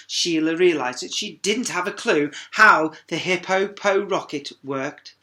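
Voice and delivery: ordinary delivery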